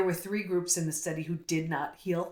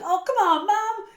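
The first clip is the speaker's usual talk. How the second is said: much higher and louder, mock-whiny protest; exasperated teen groan; 14 semitones higher, 7 dB louder than usual. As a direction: whine it like a kid rolling their eyes at mom. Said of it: "they were gonna be like".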